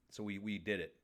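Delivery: a calm satisfied recap; quiet, settled. Real, not acted. Host calming down at the end, soft satisfied recap. Winding down.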